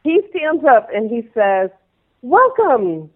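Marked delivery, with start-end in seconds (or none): 2.2-3.1 high, slow, booming welcome; formal announcer. Retold or imitated. Choreographer welcomes dancers to the Kings audition, announcer style. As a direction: announce it like an event host.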